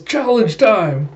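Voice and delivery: high, loud, stretched-out call; acted battle cry. Retelling a setter calling to his partner, playful mock battle cry.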